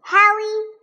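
plain reading voice

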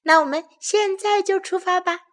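high, eager cub voice; happy cheer. A narrator's reading. Bear cub urging family to set off, excited cheer. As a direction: call it out, keen to get going. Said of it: "高兴地欢呼起来".

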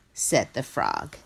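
ordinary delivery, nothing marked

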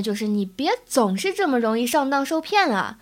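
ordinary delivery

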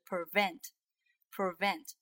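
prevent slowly, with a lip-on-teeth V; clear V sound. Shown as a demonstration. Teacher correcting learners' mistake, true V in prevent. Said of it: "是要下唇的".

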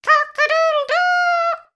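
very high, loud crowing; piercingly high storybook cock-crow; rooster crow. A narrator's reading. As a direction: crow like a rooster, long last note. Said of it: "crowed the rooster".

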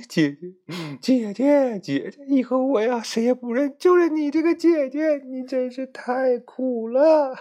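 high, loud wailing cry of Xiaolan; 哭腔高喊姐姐; crying sister. Acted. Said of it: "一边哭一边说". (尖声哭喊)

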